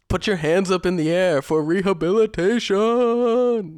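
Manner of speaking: high, loud, slow club DJ hype shout; hype DJ call. Pretend. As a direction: work the crowd like a club DJ.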